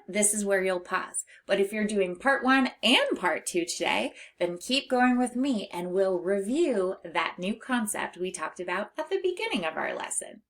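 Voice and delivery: ordinary talk, nothing marked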